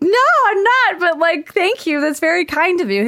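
high, loud protest easing into thanks; imitating an overwhelmed, flattered self; flustered retold denial. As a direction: protest high, then settle into politeness. Acts out flustered reply to admirer.

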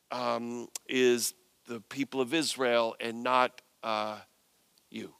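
awkward, hesitant brush-off. Acted. Jesus turning down the pleading woman, awkward and hesitant. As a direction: let her down haltingly, stalling on each phrase.

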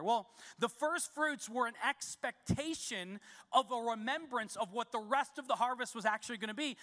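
ordinary delivery

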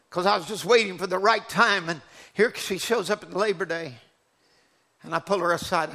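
ordinary talk, nothing marked

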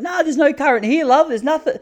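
put-on high, sweet old-lady voice; old auntie voice. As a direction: play the oblivious elderly aunt. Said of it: "She's like".

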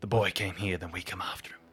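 hushed whisper, dying away. Acted. Man whispers his dream to companion, hushed ominous whisper. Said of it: "and whispers".

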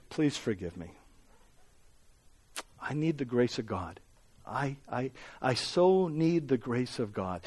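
quietly confess, as if asking forgiveness; hushed, personal confession. Real, not acted. Pastor apologizing to Tanner and Brant, softly and contritely. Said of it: "This is very personal".

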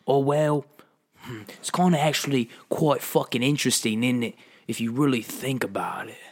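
put-on British film star; British tough guy telling an anecdote; Jason Statham. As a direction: muse in a London accent. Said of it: "Well, as Jason Statham".

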